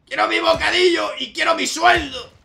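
high furious shout; angry demand. Pretend. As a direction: howl it like a tantrum. Rampaging office worker in game.

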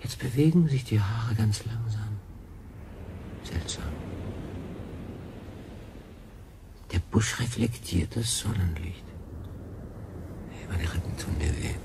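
awed, fading murmur at the eerie bush; feverish whisper. Acted. Feverish castaway whispering to himself about the strange bush, hushed and fading.

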